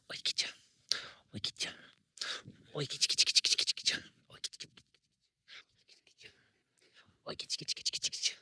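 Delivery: whispered coaxing chant; whisper-coax the dog to play; playful whisper call. Real, not acted. Trainer whisper-coaxing the dog to play.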